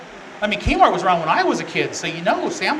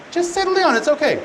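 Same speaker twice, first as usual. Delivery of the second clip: high-pitched imitation of a soothing husband; mock placating; 9 semitones higher than usual. Advising guys what never to tell wives, mock placating. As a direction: toss it out as the foolish thing to say.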